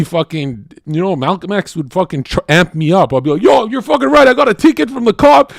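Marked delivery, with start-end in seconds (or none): 3.4-5.5 much higher and louder, pumped; fired up. Pretend. Fan fired up by Malcolm X, loud excited hype. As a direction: shout it like you're ready to march.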